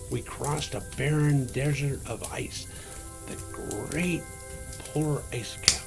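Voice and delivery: plain reading voice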